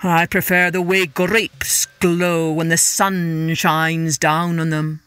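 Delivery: slow, low-key divine reply; drawn-out, stately god voice; measured god. A narrator's reading. God gently rebuffs king's boast.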